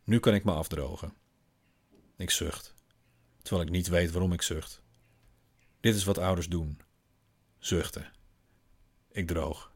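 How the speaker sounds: ordinary delivery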